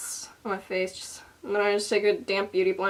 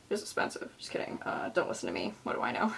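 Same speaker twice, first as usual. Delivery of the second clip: muttered aside; 9 semitones lower, 11 dB quieter, faster than usual. Real, not acted. Muttered self-undercutting asides.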